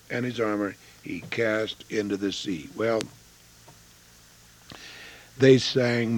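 normal talking voice

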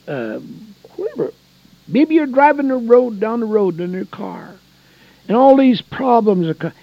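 loud, urgent preacher voice; fervent preacher pleading with listeners; preaching at full force. Real, not acted. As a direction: drive the point home.

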